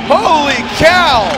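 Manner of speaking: loud, slow amazed exclaim; surprised. Real, not acted. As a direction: gasp it out loud. Crash during live drift battle.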